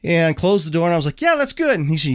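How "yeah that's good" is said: relieved. Retold or imitated. Relieved after resetting the car.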